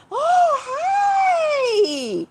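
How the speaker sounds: very high, loud delighted hello; excited squeal. Real, not acted. Greeting viewer Edward in the live chat with a delighted squeal. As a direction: squeal hello to a friend who just joined.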